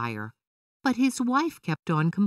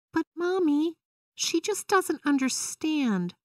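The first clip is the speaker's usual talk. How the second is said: put-on pleading child; 11 semitones higher than usual. A narrator's reading. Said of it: "Vera said".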